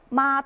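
Mandarin first tone on 마. Shown as a demonstration. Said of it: "이미 음이 높아요".

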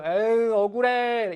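high, drawn-out whiny sigh; sulky, exaggerated lament; aggrieved pout. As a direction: moan it through pushed-out lips. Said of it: "억울한 표정으로 말하고 있어".

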